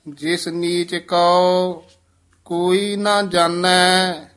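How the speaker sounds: scripture recitation. Acted. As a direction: recite it slowly and clearly to the class.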